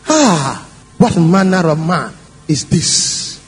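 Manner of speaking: low, slow, hushed-in-awe wondering; awed wonder. Acted. Disciples wondering to one another about Jesus, low awe. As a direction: draw it out deep in wonder. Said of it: "wondered, saying one to another".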